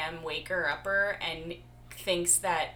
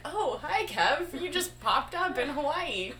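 surprised greeting; 11 semitones higher, 5 dB louder than usual. Retold or imitated. Retold greeting on live video.

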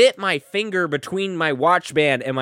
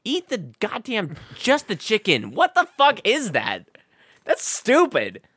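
high, indignant rant; riled up; 6 semitones higher than usual. Retold or imitated. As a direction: rant like it's outrageous. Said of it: "get so riled up".

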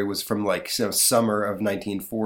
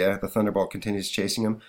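usual speaking voice

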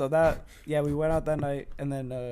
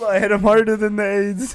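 cracking up; 4 semitones higher, 7 dB louder than usual. Real, not acted. Repeating a slip amid hysterics.

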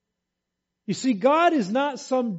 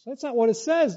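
usual speaking voice

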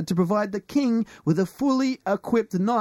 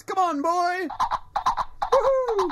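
excited whoop; 12 semitones higher than usual. Acted. Riding pony round house.

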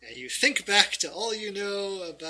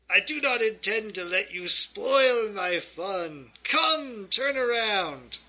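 louder, higher, theatrical villain voice; commanding villain; 5 semitones higher, 6 dB louder than usual. Acted. Villain ordering the adventurers to turn around, commanding. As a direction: draw out the order menacingly.

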